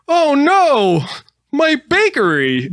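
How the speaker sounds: high, loud, dismayed wail; wailing baker. Pretend. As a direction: cry out over the ruined bakery. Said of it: "the baker comes out and he's like".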